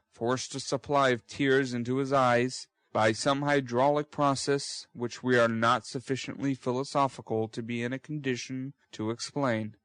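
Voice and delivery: ordinary delivery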